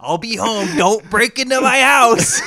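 loud, high joking holler to burglars; mock shout. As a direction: call it out, drawing out the last words.

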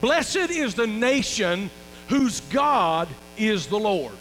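slow, weighty reading of the verse; scripture reading. Shown as a demonstration. Pastor reads a psalm to the congregation, slow and weighty. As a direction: lean on Blessed and read it slowly. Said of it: "Psalm thirty-three and twelve".

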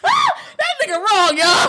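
high laughing cry. Real, not acted. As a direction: laugh while shouting it. Cracking up at friend.

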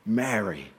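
low, soft, tender Mary; a soft call of Mary. Retold or imitated. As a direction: say her name gently, like Jesus. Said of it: "he called her by name".

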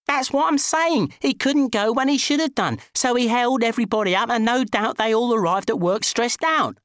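high-pitched, worked-up insistence; exasperated arguing. A narrator's reading. Driver defends telling off a stranded motorist, exasperated.

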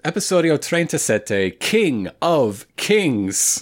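grand announcer, slowing right down on the English title; title announcement. Pretend. Podcast host introducing the next episode, grand title announcement.

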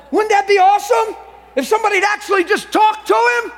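very high, full-voiced yell; impassioned shout. Real, not acted. Preacher urging churchgoers to talk to Jesus, impassioned high shout. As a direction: shout it out to the whole room. Climax of plea to congregation.

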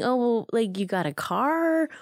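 mildly mock the dad's innocent guess; imitating dad's guess. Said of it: "he was thinking".

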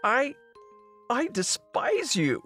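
high, slow, halting declaration; wounded, drawn-out outburst; tearful contempt. Acted. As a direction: force it out through hurt, pitch way up. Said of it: "Tears pour down Marley's face".

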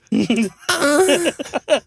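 high laughing words. Real, not acted. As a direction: talk through the giggles. Laughing at his own elephant scream.

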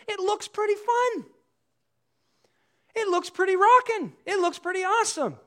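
high-pitched gushing over a party lifestyle; envious enthusiasm. Acted. Voicing a listener envying social media posts.

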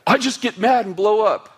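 high-pitched mock protest; hammed-up excuse, pitched right up; mimicking an objector. Hot-tempered churchgoer to pastor, high-pitched mock protest.

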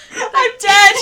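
cracking up completely, barely able to speak; helpless laughter. Real, not acted. Collapsing with laughter at recipe.

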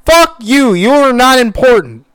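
high, loud furious yelling; angry rant. Real, not acted. Ranting at the TV host.

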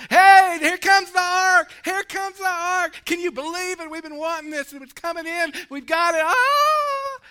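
high, full-voiced celebratory shouting; excited shouts. Preacher acts out Israel bringing up the ark, shouting excitedly. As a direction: shout it like a crowd cheering an arrival.

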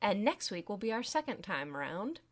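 usual speaking voice

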